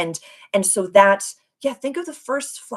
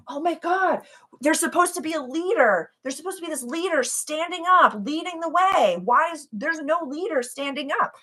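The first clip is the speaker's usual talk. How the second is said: higher, mock-bewildered complaining; mock bewildered follower; 8 semitones higher than usual. Host mocks herself wondering where the leader is, bewildered. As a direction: play a lost seeker looking for a leader. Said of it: "that joke where I'm always like".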